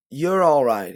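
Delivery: lightly voiced, alarmed Southern policeman; policeman backing off. A narrator's reading. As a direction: hastily decline as a Southern policeman. Policeman nervously backing away.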